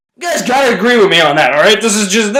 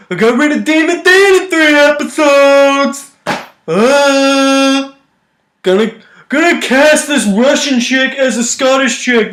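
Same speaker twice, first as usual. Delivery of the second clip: higher, dopey mock showrunner voice; 6 semitones higher than usual. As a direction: play the clueless showrunner you're mocking. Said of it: "he's just like this".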